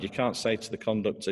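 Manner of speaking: usual speaking voice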